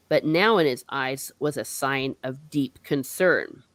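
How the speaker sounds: ordinary talk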